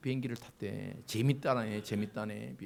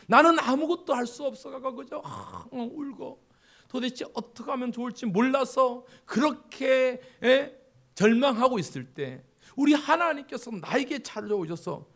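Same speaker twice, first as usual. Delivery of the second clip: loud, higher, full-force preaching; impassioned preaching; 7 semitones higher, 7 dB louder than usual. Real, not acted. Preacher pleading loudly to the congregation. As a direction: preach it with fire.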